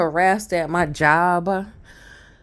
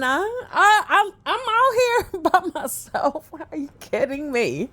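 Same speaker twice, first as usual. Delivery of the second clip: high, laughing exasperation; laughing disbelief; 9 semitones higher than usual. Real, not acted. Telling the audience she lives alone, high and laughing. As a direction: laugh at how absurd it is.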